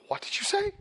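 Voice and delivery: high-pitched incredulous question; mock astonishment, voice shooting up; startled boy. Young guest questioning his friend in bed, startled.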